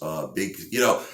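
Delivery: normal talking voice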